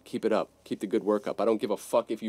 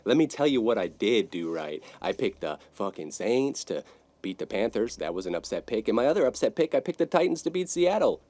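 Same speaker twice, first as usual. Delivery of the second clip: ordinary talk, nothing marked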